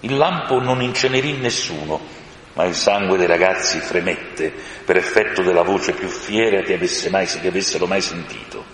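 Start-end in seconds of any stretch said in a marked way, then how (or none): none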